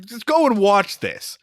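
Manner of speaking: ordinary delivery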